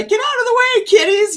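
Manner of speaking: high, loud shout at the cats; mock exasperated yell at cats; called out. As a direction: shoo the kitties out loudly. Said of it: "followed by".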